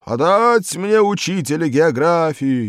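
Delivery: high, loud raging bellow; loud predatory yell; howling roar. A narrator's reading. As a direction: howl the order at the whole school. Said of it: "громовой хищный бас взвыл вновь".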